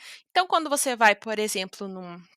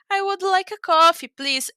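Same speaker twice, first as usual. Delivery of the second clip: higher, louder, friendly polite request; 6 semitones higher, 5 dB louder than usual. Shown as a demonstration. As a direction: ask nicely, bright and courteous. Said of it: "uma maneira mais simpática de dizer". (pedido simpático e educado)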